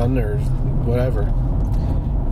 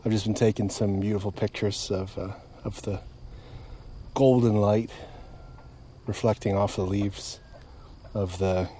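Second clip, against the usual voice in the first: calm and soft; 3 semitones lower, 7 dB quieter than usual. Real, not acted. Calm sunset update, softer.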